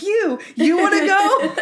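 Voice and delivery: high, loud, slow mock tough guy, laughing through it; hammed-up schoolyard dare; mock challenge. Monk dares Stottlemyre to fight, hammed-up and laughing.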